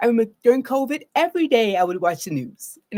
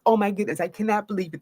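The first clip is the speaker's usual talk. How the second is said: normal talking voice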